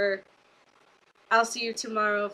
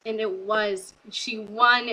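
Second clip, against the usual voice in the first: normal talking voice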